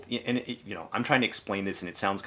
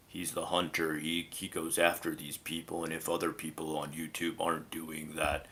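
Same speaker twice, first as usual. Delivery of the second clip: low, softer imitation of a grave vigilante; mock deep hunter voice; 3 semitones lower, 4 dB quieter than usual. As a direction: drop into a deep self-important voice, drawing out the end. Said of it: "deep voice that he always uses".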